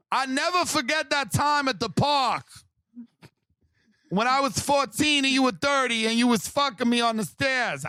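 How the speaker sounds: shouting lovesick post in a put-on accent; mock Spanish accent. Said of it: "that one chick that keeps posting".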